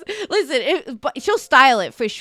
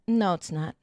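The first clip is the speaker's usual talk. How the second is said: retold contradiction; 5 semitones lower, 6 dB quieter than usual. Flat contradiction in retold argument.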